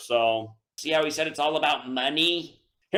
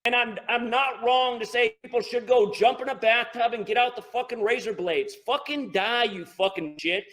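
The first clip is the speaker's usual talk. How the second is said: angry rant; 6 semitones higher than usual. Real, not acted. Raging at caller.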